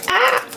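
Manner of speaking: sudden high yelp of surprise; startled shriek. Real, not acted. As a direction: jump and let out a sharp cry.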